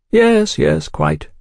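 high, airy agreement; agreeable. A narrator's reading. Oliphant smoothly agreeing with hostile Velasco, agreeable. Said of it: "Oliphant agreed".

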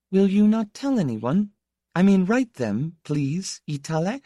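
put-on high, light young lady voice, earnest; young lady, hesitant. Young woman telling an old friend of her engagement, hesitant. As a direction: play a hesitant young lady, pitched well up.

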